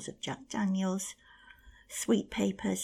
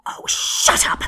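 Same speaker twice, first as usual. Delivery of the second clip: furious scream, much louder; 18 dB louder, slower than usual. Acted. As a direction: scream it to cut her off. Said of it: "screamed Ereshkigal".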